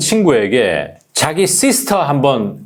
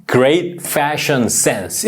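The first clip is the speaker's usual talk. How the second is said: slow demo; much slower than usual. Shown as a demonstration. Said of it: "그다음에".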